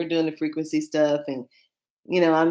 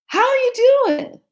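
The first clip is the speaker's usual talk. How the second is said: high, loud, sing-song hello; loud excited greeting; 8 dB louder than usual. Retold or imitated. Reader greeting a returning client, loud high delight. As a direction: call out a big delighted hello. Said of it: "And I go".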